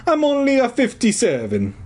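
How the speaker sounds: high-pitched stage-Italian; Italian accent. A narrator's reading. Janitor setting his age straight, Italian accent. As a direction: insist in hammed-up Italian. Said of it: "his hoarse Italian voice".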